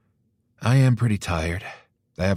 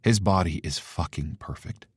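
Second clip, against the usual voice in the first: plain reading voice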